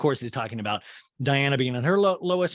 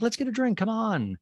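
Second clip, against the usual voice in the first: raised-pitch coaxing voice; mocking quote; 7 semitones higher than usual. Host quotes housewife inviting friend for a drink, higher mock voice. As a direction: lightly mimic her, pitched up. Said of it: "and she's like".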